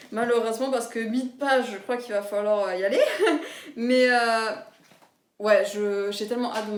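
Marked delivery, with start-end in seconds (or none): 3.0-3.7 a quick bright giggle; short laugh. Real, not acted. Booktuber laughing at her busy schedule, short giggle. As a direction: laugh it off lightly. Laughs at own reading time.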